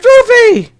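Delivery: high, loud yell of the name; excited shout. Retold or imitated. Fan yelling at the movie screen, excited high shout. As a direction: shriek it like a fan spotting a favourite. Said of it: "I said".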